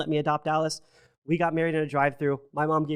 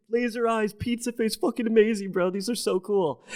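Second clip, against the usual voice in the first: high excited gushing friend, much higher; 9 semitones higher than usual. Retold or imitated. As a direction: gush like a hyped-up buddy. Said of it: "He's like".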